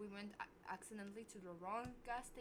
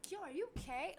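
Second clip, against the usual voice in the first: feigned concern; 7 semitones higher, 4 dB louder, faster than usual. Retold or imitated. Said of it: "pretending that I'm asking him".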